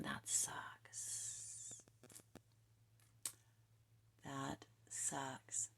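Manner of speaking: breathy whispered aside to herself. Real, not acted. Tarot reader whispers to herself over a harsh truth, hushed. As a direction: drop to a whisper, half laughing at the sting.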